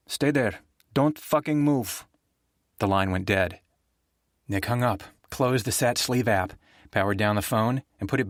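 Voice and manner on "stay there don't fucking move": higher, slow, emphatic order; commanding woman. Acted. Rita ordering Nick to stay put, slow and emphatic. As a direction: lay down the order word by word.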